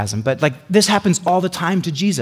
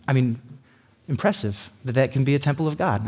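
ordinary talk

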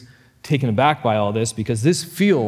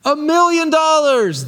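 much higher, louder all-in shout; shouting a million; 13 semitones higher, 5 dB louder than usual. Acted. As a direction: stun the whole crowd. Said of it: "the guy shouts".

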